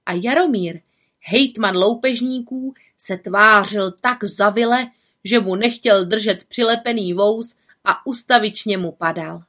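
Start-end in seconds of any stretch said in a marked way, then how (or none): none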